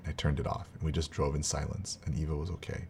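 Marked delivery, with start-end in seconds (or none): none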